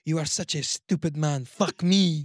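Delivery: hammed-up French-accented English; mock French accent. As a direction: play a Frenchwoman scolding her lover. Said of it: "that French accent that's speaking English".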